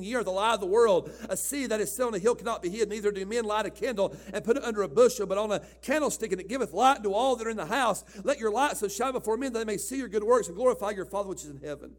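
steady, proclaiming King James reading; scripture reading. Acted. Preacher reads Matthew to the congregation, proclaiming. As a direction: read it out like holy writ.

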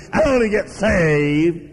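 slow, put-on careless convert voice; mock gum-chewing convert. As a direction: mockingly drawl it as if chewing gum. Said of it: "grinning, chewing gum, smacking away".

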